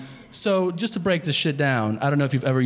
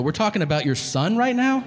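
higher-pitched disbelieving question; incredulous; 6 semitones higher than usual. Retold or imitated. Caller questions a father insulting his son, incredulous. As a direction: check that he really means it.

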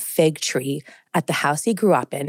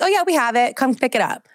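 lightly voiced, higher-pitched phone helper; a hint of high-pitched customer-service voice; restaurant staff; 6 semitones higher than usual. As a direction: imitate an obliging worker taking a call, pitched up.

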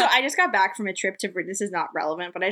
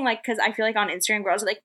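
ordinary delivery

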